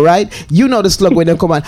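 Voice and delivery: usual speaking voice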